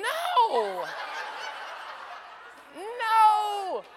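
high, drawn-out teasing no; mock-scandalized no to the crowd; playful no. Real, not acted. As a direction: shoot the guess down with a grin. Playfully rejecting a wrong answer.

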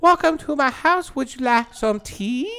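high, slow mock Chinese accent; imitating a Chinese accent. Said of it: "She's like".

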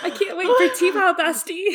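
high, loud, laughing squeal; giddy excitement. Real, not acted. Host telling bestie they can't wait, giddy and laughing. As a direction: gush it through laughter.

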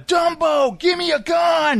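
high, urgent imitated yell; hammed-up high film shout; mock action-hero shout. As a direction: holler for help like a badly dubbed action star. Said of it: "And he's like".

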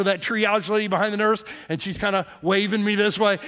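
ordinary talk, nothing marked

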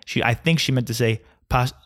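usual speaking voice

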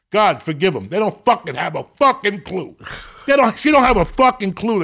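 loud, exasperated mock prayer; Jesus on the cross. Jesus begging God to forgive the clueless, hammed-up loud. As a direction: belt it out like Jesus pleading upward. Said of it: "when Jesus was hanging there and he goes".

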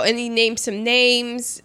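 usual speaking voice

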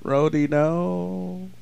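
very slow hammed-up sorrow; mock lament. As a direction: drag it out in fake grief. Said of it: "It's like".

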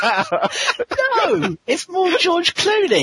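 real laughter through the words; high-pitched, laughing interjection. Real, not acted. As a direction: correct them while cracking up. Reader cracks up over the script.

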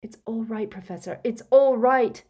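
loud reassurance. A narrator's reading. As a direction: insist over the screaming, anxiously. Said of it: "said Harry loudly".

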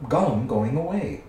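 ordinary delivery, nothing marked

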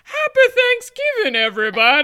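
very high, loud mock-festive yell; hammed-up holiday shout. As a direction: belt out the greeting in an over-the-top high voice.

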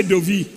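"dovi" in two syllables, "doh-vee"; Ewe pronunciation. Retold or imitated. Preacher teasing how Ewes say it, "dovi" as doh-vee. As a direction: end "dovi" on a clear ee. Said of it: "The airways say dovi".

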